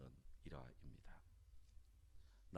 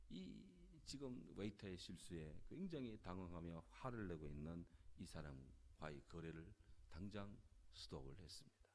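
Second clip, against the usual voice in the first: ordinary delivery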